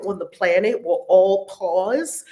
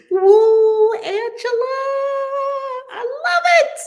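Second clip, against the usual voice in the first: high, loud whoop of delight; excited cheer; 7 dB louder, slower than usual. Real, not acted. Host cheering on a member's share, excited cheer. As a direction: squeal your delight in a very high voice.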